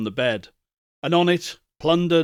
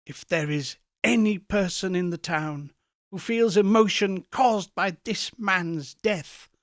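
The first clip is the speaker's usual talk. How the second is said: much higher, fast anguished plea; desperate Scrooge; 6 semitones higher than usual. Acted. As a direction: cry out your helplessness.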